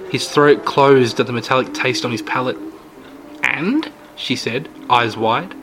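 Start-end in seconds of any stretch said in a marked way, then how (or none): 3.4-3.9 put-on old lady voice. Old lady eager question.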